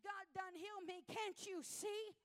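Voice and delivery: high and loud, slowing on Can't you see; joyful shout. Retold or imitated. Healed woman answers the follower, loud high proclamation. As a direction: proclaim the healing to the doubter. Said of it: "She said".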